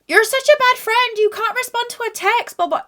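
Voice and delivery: high, far mocking friend voice; mocking whiny friend.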